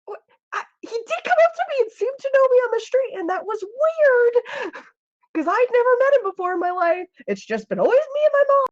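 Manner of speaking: bewildered twin. Acted. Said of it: "And Faith is just like".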